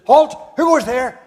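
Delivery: high, loud sentry challenge; German soldier challenging a noise in the dark, shouting; German guard's shout. Acted. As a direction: bark it out like a guard on the wall. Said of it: "the German soldiers heard him. Said".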